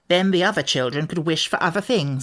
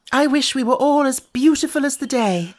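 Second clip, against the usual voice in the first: in a great hurry; 8 semitones higher, 4 dB louder than usual. A narrator's reading. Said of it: "she said in a great hurry".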